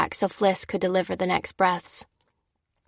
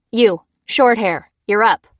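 curt order; 3 semitones higher, 7 dB louder than usual. A narrator's reading. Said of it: "said the clerk".

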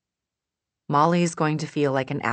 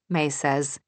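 ordinary delivery, nothing marked

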